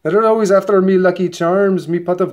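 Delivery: high, loud mock Irish brogue; hammed-up Irish leprechaun voice; Irish leprechaun accent. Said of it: "I think Meowth is, is Irish".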